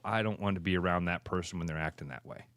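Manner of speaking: muttered low and quick; a quick private thought, half under the breath; quiet fast aside. Retold or imitated. Quoting own later thought.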